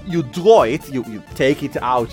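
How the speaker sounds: normal talking voice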